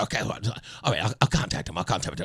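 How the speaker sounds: friend on phone. Retold or imitated.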